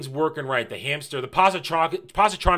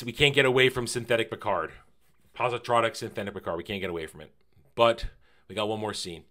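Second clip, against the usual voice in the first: usual speaking voice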